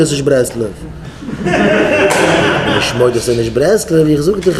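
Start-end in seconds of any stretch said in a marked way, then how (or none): 0.7-2.7 high laugh. Acted. Laughs while acting out the exchange.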